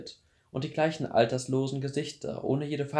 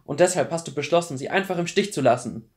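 raised, loud and indignant; furious; 5 semitones higher, 7 dB louder than usual. A narrator's reading. Clary accuses Luke of abandoning her mother, furious. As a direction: throw the accusation at him angrily. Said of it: "erwiderte Clary wütend".

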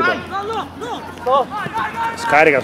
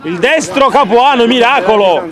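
breathless italian goal commentary; 8 semitones higher, 6 dB louder than usual. Acted. Live save commentary.

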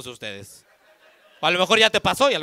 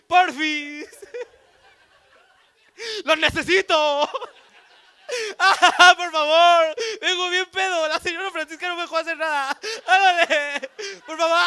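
higher, louder, mock-crying grown man; husband begging his wife, hammed-up child whine; hammed-up whiny begging; 7 semitones higher, 7 dB louder than usual. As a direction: beg your wife like a tearful kid.

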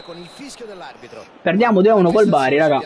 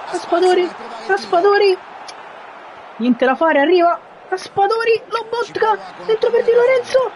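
building to a high, breathless peak; breathless italian goal commentary; 9 semitones higher than usual. Real, not acted. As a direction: shout the scramble as it climbs. Goalmouth scramble, near equaliser.

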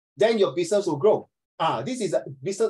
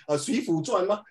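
ordinary talk, nothing marked

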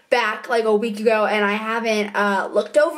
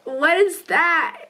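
ordinary talk, nothing marked

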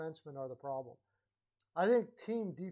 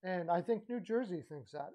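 ordinary talk, nothing marked

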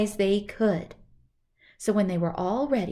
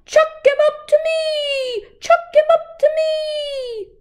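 much higher, louder, slow crowing cry; rooster hollering; 9 dB louder, slower than usual. Acted. Robber imitating the rooster hollering from the roof, crowing. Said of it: "kept a-hollering".

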